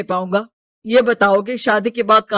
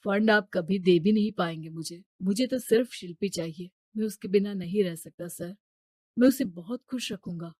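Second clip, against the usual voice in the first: softer, humble plea; soft pleading; 2 semitones lower, 10 dB quieter than usual. Acted. Suitor pleading to the father, soft. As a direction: gently beg for her hand.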